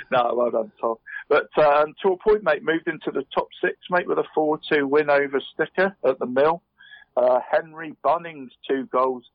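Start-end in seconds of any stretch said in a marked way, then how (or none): none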